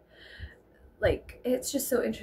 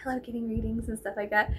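hushed whisper, confiding; 3 semitones lower, 8 dB quieter, faster than usual. Retold or imitated. Girl confiding her love of readings, whispered. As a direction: breathe it like a confession. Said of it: "And then she was like".

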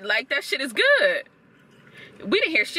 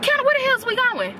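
calling out; 11 semitones higher, faster than usual. Real, not acted. Yelling at friend walking ahead.

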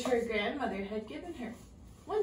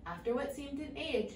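plain reading voice

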